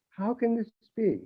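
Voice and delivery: ordinary delivery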